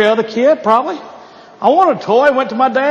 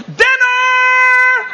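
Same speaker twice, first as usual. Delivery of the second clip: calling out; 13 semitones higher than usual. Retold or imitated. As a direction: holler it to bring the kids home. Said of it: "the magic word that she would announce".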